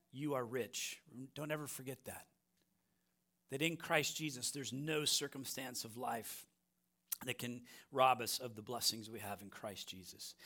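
quiet pastoral reassurance; hushed assurance. Real, not acted. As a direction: drop to a soft, intimate hush. Pastor softens to intimate assurance.

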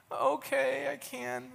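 put-on high, small, giving-in child voice; reluctant kid. Boy agreeing to coach, meek. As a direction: give in meekly like a boy.

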